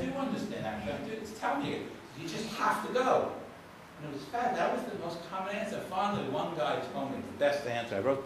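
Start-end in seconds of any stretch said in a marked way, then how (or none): 0.0-3.3 high, softer, coaxing insistence; insistent coaxing. Retold or imitated. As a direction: coax the answer out of him. Insisting to the traveler.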